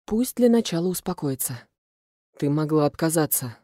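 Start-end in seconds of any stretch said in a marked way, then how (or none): none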